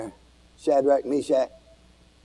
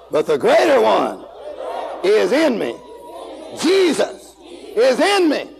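preaching at full force; 11 semitones higher, slower than usual. Acted. Preacher to his congregation, rising to a shout.

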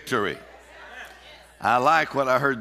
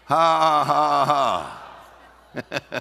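loud, deliberate "ha ha ha"; forced laugh in the face of trouble; demonstrated laugh; 3 dB louder, much slower than usual. Shown as a demonstration. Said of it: "Look your problem in the face and laugh".

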